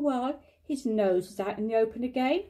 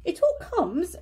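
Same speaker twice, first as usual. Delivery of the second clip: firm and higher, lecturing; stern; 6 semitones higher than usual. A narrator's reading. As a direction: scold him sternly, pitch up. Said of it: "said Rabbit sternly".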